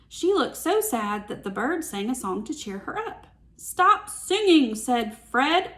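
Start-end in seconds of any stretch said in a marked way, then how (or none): 3.5-4.7 high, loud, slow mock command; hammed-up cranky dragon; annoyed order. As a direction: snap at the singing bird.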